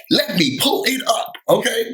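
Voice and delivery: high, sassy catchphrase. Shown as a demonstration. Host signing off the retold fight with her catchphrase, sassy. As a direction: fling it out with attitude. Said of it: "that's my new thing".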